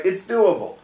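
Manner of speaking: "doable" pitched high, then falling; stress on DOABLE. Shown as a demonstration. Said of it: "putting the emphasis on the doing".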